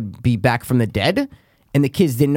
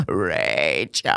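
much lower, softer, eerie croak of a name; creepy Zelda voice; 10 semitones lower, 5 dB quieter than usual. Retold or imitated. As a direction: call the name like a dying ghoul. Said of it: "she's like".

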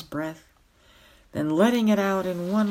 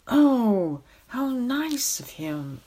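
higher, delighted "Oh" burst out; delighted exclamation; 7 semitones higher, 4 dB louder, slower than usual. A narrator's reading. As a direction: gush with childlike delight. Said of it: "exclaimed the king again".

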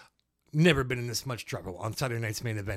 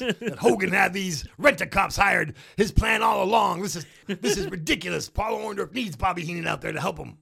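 Jesse Ventura rant; 6 semitones higher, 5 dB louder than usual. Retold or imitated. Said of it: "What does Jesse say?".

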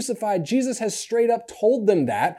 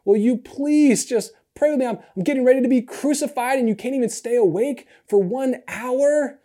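higher, pleading with exasperation; begging them to stay awake; Jesus shaking his sleeping disciples, frustrated plea; 6 semitones higher than usual. Retold or imitated. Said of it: "He's like".

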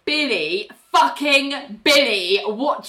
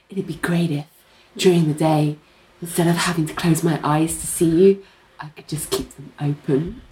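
seductive; 4 semitones lower than usual. Retold or imitated. Voices Ling seducing Richard.

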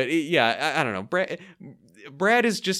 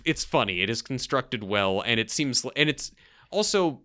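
ordinary talk, nothing marked

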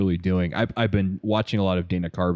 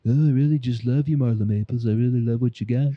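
old Trump impression; 4 semitones higher than usual. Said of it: "he combines the old Trump".

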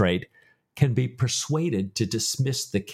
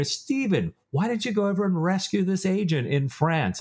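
suspicious questioner; 8 semitones higher than usual. Retold or imitated. Imagined accuser questioning Stephen.

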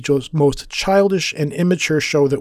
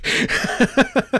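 breathy high laugh; 8 semitones higher than usual. Real, not acted. Laughing at going overboard.

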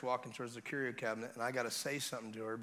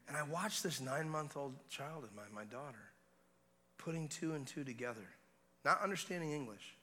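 ordinary talk, nothing marked

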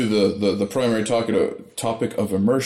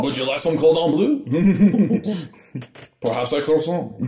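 higher, hammed-up French accent; mock-French waiter offer, drawn out; 6 semitones higher, slower than usual. Joining in as a French dwarf, playful French accent. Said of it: "speaking in French accents".

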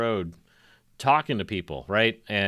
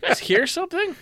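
high, muffled calls from inside a coffin; woman in a coffin calling up to the diggers, high and plaintive; buried woman calling; 11 semitones higher, 4 dB louder than usual. Acted. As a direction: call out timidly from underground. Voicing woman buried alive.

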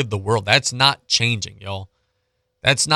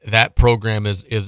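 usual speaking voice

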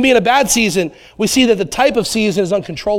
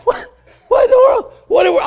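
shocked; 14 semitones higher, faster than usual. Retold or imitated. Dad shocked by son's line.

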